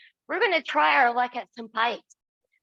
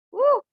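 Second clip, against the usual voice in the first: a short strained woo mid-exercise; effortful woo; 12 semitones higher, 5 dB louder than usual. Real, not acted. Instructor whooping through a tough move, short effortful woo. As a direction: let out a quick effort whoop. Exertion during hard exercise.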